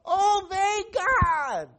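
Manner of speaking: very high, loud, stretched-out shout; joyful shouted finale; big group yell. Shown as a demonstration. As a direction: belt it out with the kids. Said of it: "and we all yell".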